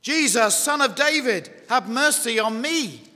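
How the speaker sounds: high, loud plea for mercy; calling out. Acted. Blind Bartimaeus to the Lord Jesus, calling out. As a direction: cry it out as if to someone passing. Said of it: "Now he called out first of all".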